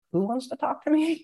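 small, high, soft mock-scared voice; timid. Scared newcomer answering a messenger, mock timid. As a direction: squeak it out softly, dreading the answer.

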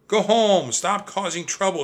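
high, loud scolding yell. A narrator's reading. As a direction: scold the Vikings from the window. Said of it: "she yelled to the Vikings".

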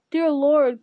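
loud, pleading outcry; anguished cry. A narrator's reading. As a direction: cry out in alarm to the king. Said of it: "cried Worm-Tongue".

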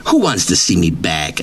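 ordinary talk

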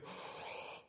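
sharp pretend-shocked intake of breath; mock scandalized gasp. Preacher imitating the holy people hearing of dancing, mock gasp. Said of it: "The holy people over there and go".

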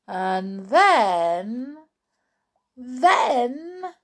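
put-on suspenseful storytelling for children; animated narrator drawing out each step; story narration. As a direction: build the suspense with dramatic pauses. Reads story aloud with suspense.

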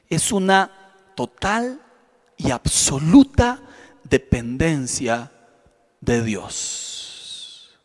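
slow down and fade on each word; slow fading emphasis. Acted. Pastor stressing total dependence on God, slow and fading.

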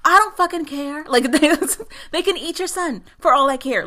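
high, sarcastic fake sympathy; retelling a hacker mocking a mother's plea, high and sarcastic. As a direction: sneer at her worry in a high mock voice. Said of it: "Like, he mocks her".

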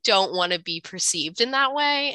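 ordinary talk, nothing marked

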